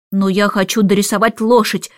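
ordinary delivery, nothing marked

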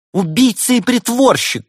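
high, shrieked accusation; shout. Acted. Girl in love accuses the doctor, shouting. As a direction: cry out loud and very high. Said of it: "крикнула влюблённая".

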